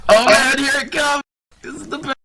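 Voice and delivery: ordinary talk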